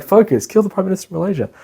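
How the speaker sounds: movie villain quote. Retold or imitated. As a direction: rattle it off like a brainwashing order. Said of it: "It's like".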